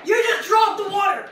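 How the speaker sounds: loud laughing shout. Real, not acted. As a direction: yell it out, amazed. Laughing shout during challenge.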